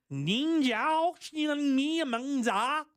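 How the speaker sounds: high, very slow mock Mandarin; mock Chinese. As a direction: sound out the Chinese words, high and drawn out. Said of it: "That's terrible".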